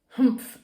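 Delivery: loud, high thud of effort; effort grunt. Acted. Mother throwing herself at door, grunting. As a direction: slam into the door.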